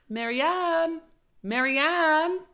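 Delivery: high, loud call across the house. Acted. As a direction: shout the name to someone out of sight. Rabbit calls for his maid.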